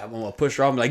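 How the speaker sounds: ordinary talk, nothing marked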